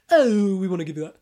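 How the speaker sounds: mock menace with a knife; sinister quiz host. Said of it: "he'd say".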